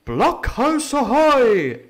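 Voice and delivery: slow, loud, high hail from afar; a drawn-out ahoy shouted to the blockhouse; hailing cry. A narrator's reading. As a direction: call it out long across the clearing. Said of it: "it cried".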